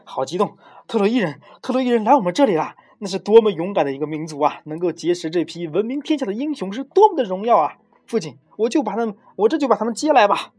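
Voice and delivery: put-on excited young prince. A narrator's reading. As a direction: gush to your father with excitement. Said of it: "一边激动地对父亲说啊".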